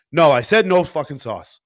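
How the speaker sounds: loud, high furious snap; snapping. Retold or imitated. Rude customer yelling at the sandwich girls, loud and high. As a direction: bite their heads off over the sauce. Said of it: "is snapping at these young".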